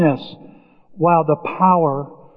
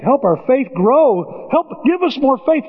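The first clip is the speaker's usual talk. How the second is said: high, slow begging disciples; mock pleading, high; 7 semitones higher, slower than usual. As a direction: beg for faith, pitched up. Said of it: "They say".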